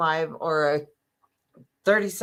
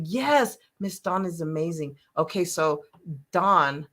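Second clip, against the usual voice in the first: ordinary delivery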